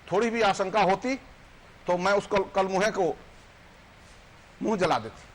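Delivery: declaimed reproach, questions pressed home; impassioned poem recitation. Acted. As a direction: recite it as a wounded wife's accusation, pausing between lines. Ahalya's poem accuses her husband.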